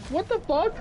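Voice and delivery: high-pitched squeal of disbelief; incredulous outburst. Retold or imitated. Imagining Souls pausing for loot, high disbelieving shriek. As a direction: shriek as if the game just froze. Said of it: "I'd be like".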